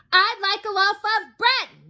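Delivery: high, loud, slow shout. Acted. Mister Noisy shouts at the baker's wife again, slow shout. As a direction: shout it so she must hear. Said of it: "Mister Noisy shouted".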